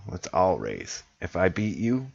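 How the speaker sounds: ordinary talk, nothing marked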